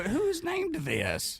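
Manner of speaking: high, drawn-out, stretched-out disbelief; incredulous squeal. Retold or imitated. Host imagining reacting to the name, a high slow squeal. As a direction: squeak it out slowly, as if you can't believe it.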